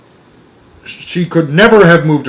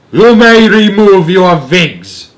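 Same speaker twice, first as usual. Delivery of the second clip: slow, loud, higher snarl; 7 semitones higher, 7 dB louder, slower than usual. A narrator's reading. Grand High Witch snarling her order at the audience. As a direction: snarl it with guttural contempt. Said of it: "snarled the Grand High Witch".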